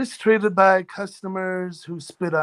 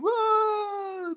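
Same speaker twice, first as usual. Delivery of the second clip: startled cry; 5 dB quieter than usual. Retold or imitated. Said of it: "everybody's like".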